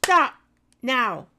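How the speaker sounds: loud high commanding shout; strong voice. Real, not acted. Owner shouting at her puppy Bruno to stop, firm command. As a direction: bark the order like you mean it. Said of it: "I have to do a strong voice".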